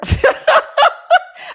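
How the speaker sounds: high, loud burst of laughter; real laugh bursting out. Real, not acted. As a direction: crack up at the mix-up. Laughing at her own misreading.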